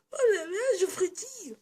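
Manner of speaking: very high squeaky thrilled squeal; giddy little snake. Retold or imitated. Vlogger mimics Maggie Cheung's cute snake, very high and giddy. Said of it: "le petit serpent qui fait".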